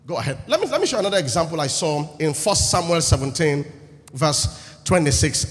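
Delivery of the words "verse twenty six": ordinary talk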